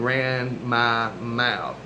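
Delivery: "ran my mouth" very slow, each word drawn out; emphatic stretching. Real, not acted. Repeats phrase for emphasis.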